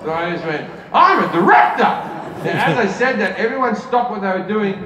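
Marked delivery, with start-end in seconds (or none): 0.9-2.0 louder, higher passionate declaration; laughing mock passion, higher and louder. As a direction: proclaim it with forced passion. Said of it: "I need passion from you".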